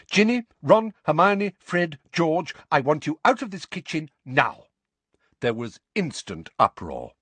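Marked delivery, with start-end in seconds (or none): 0.1-4.7 commanding. Acted. Mother ordering children out.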